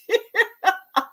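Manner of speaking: high delighted cackle; high burst of laughter. Real, not acted. Host cracks up at her cousin's comment, high laugh.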